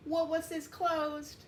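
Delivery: high, slow announcement voice of the store man; store man announcing. Acted. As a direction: call out the closing to the customers.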